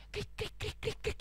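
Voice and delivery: soft, very high chirps; squeaky quick cricket noises; cricket chirping. Acted. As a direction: imitate a little cricket chirping. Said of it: "tanya sama jangkrik".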